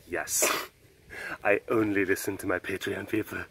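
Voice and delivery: low, soft pretend admission; mock hushed confiding. Host answering doubtful followers, in a mock hushed confiding voice. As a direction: drop low and quiet, playing it up. Acts his reply to asking followers.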